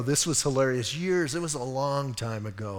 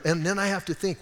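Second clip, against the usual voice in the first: ordinary talk, nothing marked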